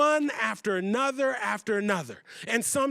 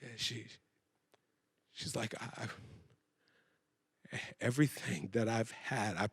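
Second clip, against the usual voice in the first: very quiet, low and halting; despairing; 11 semitones lower, 9 dB quieter than usual. Acted. Mother thinking to herself she has failed, hushed despair. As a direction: barely get it out, with long defeated pauses.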